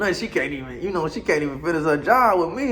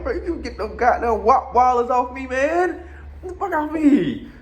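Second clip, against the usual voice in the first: annoyed; 10 semitones higher than usual. Retold or imitated. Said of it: "bro, I'm like".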